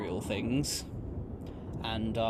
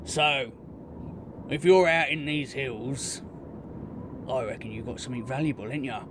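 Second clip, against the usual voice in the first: orc bandit; 3 semitones higher than usual. Acted. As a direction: press them like a greedy orc thug. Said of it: "he goes".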